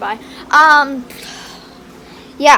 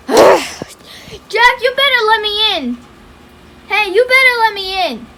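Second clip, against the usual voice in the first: raised, higher voice; demanding; 6 semitones higher, 4 dB louder than usual. Real, not acted. Locked-out housemate to Jack through the door, demanding. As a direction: insist firmly on being let in.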